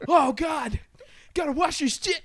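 mock panic. Teen panics as mom arrives.

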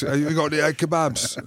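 high, silly impression of a daft question; lightly mocking voice; mocking his mate. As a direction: ask it like a clueless drunk. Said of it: "I think you asked".

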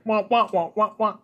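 muffled wah wah talk; wah-wah like an adult in Peanuts; Charlie Brown teacher. Retold or imitated. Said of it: "Charlie Brown teacher".

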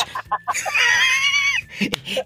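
shrill burst of laughter; high shrieking laugh. Real, not acted. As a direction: let out a squealing laugh. Laughing at memory.

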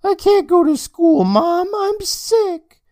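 put-on very high, whiny kid voice, half asleep; groggy groan. Sleepy brother groaning at his sister, high whiny kid voice.

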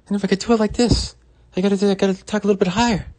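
very high, loud, rapid-fire impression; Bill Burr. Showing viewers how to do a comedian's voice, high loud impression. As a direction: push the voice way up and talk fast. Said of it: "do a Bill Burr really good".

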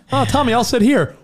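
laughing. Real, not acted. Laughing joke about accent chair.

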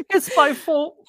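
high, tearful lament. Acted. Nettie blaming herself to Mercy, tearful. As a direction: voice her guilt through tears. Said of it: "She's in tears".

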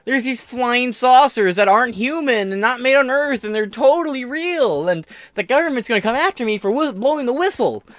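high, loud mocking impression; mocking UFO believer. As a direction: squeal it like a gullible UFO guy. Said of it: "be like".

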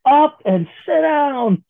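high, slow, loud snap; angry shout. Pretend. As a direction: yell at them to sit. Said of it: "could not put up with anyone".

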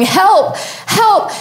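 high-pitched mock cry for help; retold yell. Hiker retelling her call to distant campers, mock yell. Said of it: "I remember just yelling".

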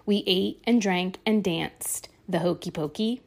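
usual speaking voice

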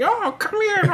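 very high old-lady imitation, laughing. Imitating a friend in old lady costume scaring someone, high old-lady voice. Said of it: "he was doing the old lady voice".

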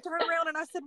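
usual speaking voice

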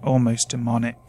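ordinary delivery

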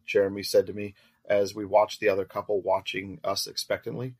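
ordinary delivery, nothing marked

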